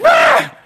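high yelped ah; hammed-up scream; mock scream. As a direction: shriek like a startled child. Kid reacting to snake.

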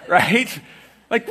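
loud, high revival preaching; fiery sermon climax; preaching at full force. Acted. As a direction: lift the room, preach it out.

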